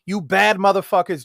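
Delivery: ordinary delivery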